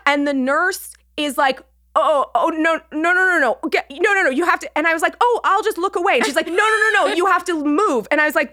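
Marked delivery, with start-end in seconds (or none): 2.0-4.7 high, flustered string of no's; panicked nurse. Retold or imitated. Nurse stopping patient walking in on a changing man, panicked. 5.2-6.2 flustered excuse. Retold or imitated. Flustered patient offering excuse to nurse. 6.5-8.1 high, urgent imitation of a nurse; head them off in alarm, pitched up; panicked nurse. Nurse telling intruding patient to move, panicked.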